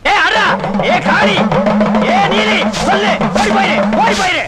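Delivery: high, urgent calls to a spirit; exorcist's command. Acted. Holy man exorcising a bride, high commanding cries. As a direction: drive the spirit out with sharp incantations.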